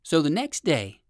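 usual speaking voice

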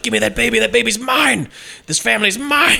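high, possessive outburst; mock villain demand. Villain nanny demanding the baby, mock frantic anger.

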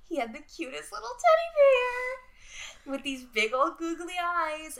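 high-pitched cooing squeal; cutesy gushing. Real, not acted. Podcaster fawns over a boy's teddy bear, cutesy gushing. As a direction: gush over something adorable in a high voice.

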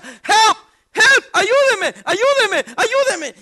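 loud, high, desperate yelling for help; panicked cry for help. Retold or imitated. Retelling a drowning friend's panic, loud desperate cries. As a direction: scream it like you're being dragged under.

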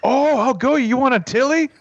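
very high mock Canadian accent; Canadian fight challenge. Imitating Letterkenny characters starting a fight, Canadian accent. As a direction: goad them in a hammed-up Canadian voice. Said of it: "in Letterkenny, you know how they'll say".

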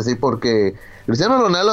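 ordinary delivery

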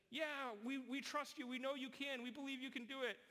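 high, eager crowd assurance; trusting crowd. Retold or imitated. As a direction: gush your trust at him. Crowd reassures the performer.

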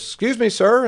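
high-pitched call to a stranger; mock holler. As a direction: holler up high, without full volume. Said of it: "hollers at him".

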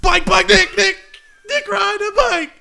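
high, slow, chopped-up wail; angry, near tears. Acted. Doctored tape of Gerald for the courts, angry and tearful. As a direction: strain each spliced word as if almost crying. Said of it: "How did you make him sound so angry?".

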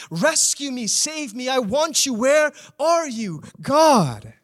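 preacher voicing a desperate believer begging God; desperate plea. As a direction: beg God to come back. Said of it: "You feel the desperation of Haman".

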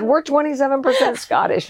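high, bright quote of sister's news; quoting sister. Retold or imitated. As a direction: announce it like the sister did. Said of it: "So she said".

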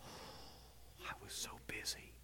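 whispered with a heavy sigh, sulking; sulky whisper. Retold or imitated. Boy sulks while setting the table, sighing whisper. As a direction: grumble it under your breath.

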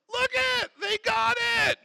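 very high-pitched acted delight. God seeing people finally get it, delighted. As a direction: exclaim like a thrilled onlooker.

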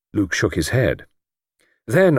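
usual speaking voice

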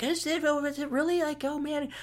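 high, soft mock-wondering voice; wondering doubters. Host voicing listeners who wonder if it's true, mock-wondering. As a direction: pitch it way up and keep it small. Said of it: "and you think".

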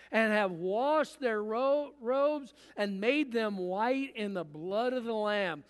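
loud, ringing Bible reading; forceful scripture reading. Acted. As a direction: proclaim the verses from the pulpit. Reads scripture passage aloud.

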